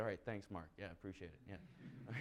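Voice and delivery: grudging, deflated thanks; sarcastic thanks. Retold or imitated. Fed-up student to friend Mark: dry sarcastic thanks.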